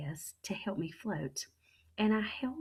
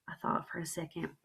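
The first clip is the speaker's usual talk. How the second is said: usual speaking voice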